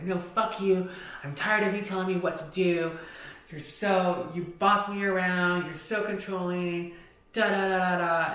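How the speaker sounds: retold angry yelling. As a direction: imitate him yelling back, trailing into da-da-da. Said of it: "he's yelling".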